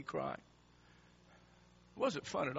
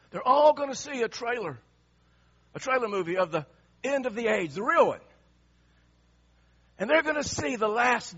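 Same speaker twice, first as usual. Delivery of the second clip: high, loud sermon building in force; full-force preaching; 7 semitones higher, 8 dB louder than usual. Acted. Preacher promises a trailer of the last day, high, building force. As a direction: drive it to a loud, emphatic peak.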